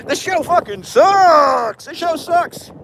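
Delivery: very high, drawn-out squawk of disgust; over-the-top rant, voice shooting way up; ranting outburst. Real, not acted. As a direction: wail it in high-pitched exasperation. Ranting meltdown about a rival show.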